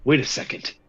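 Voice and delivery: a hushed, breathy wait a second; whispered suspicion. Acted. Sergeant realising who stands at the door, whispered. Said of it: "he goes".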